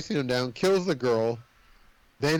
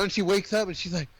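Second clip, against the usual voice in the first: ordinary delivery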